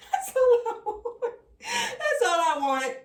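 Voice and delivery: laughing through the words, voice cracking high. Real, not acted. Tarot reader to viewers, laughing and near tears. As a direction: laugh it out, half crying. Laughing while repeating her wish.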